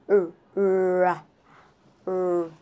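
long curled r, tongue curved back; curled r sound. Shown as a demonstration. Teacher demonstrating the r sound, curled tongue. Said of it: "you have to curve your tongue".

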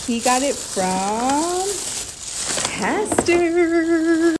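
slow teasing build then high; playful reveal. Real, not acted. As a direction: draw it out, pop the name. Teasing reveal of lunch spot.

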